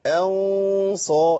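أن صا drawn out with the lips rounded; heavy ghunna onset. Shown as a demonstration. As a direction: start أن صا slowly with a thick ghunna. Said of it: "هيئة شفتين اتغيرت بس".

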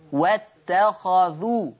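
short "a" after the kha in "واتخذوا"; fatha on kha. Shown as a demonstration. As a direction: recite "واتخذوا" slowly as wattakhadhu. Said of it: "ورش يقرأ بفتح الخاء".

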